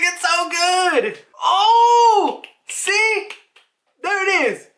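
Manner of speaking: high, drawn-out wail of food joy; hammed-up tearful delight. Narrator voices Guinea eating potatoes, mock-weepy delight.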